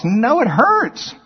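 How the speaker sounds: high, slow whine of the flesh; the flesh whining about the cross; slow whiny complaint. Acted. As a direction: drag it out like a sulking child.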